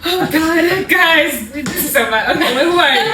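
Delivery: loud, high laughing exclamation; real laughing outburst; laughing embarrassed. Real, not acted. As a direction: crack up at how bad it is. Laughing embarrassed at self-intro mixup.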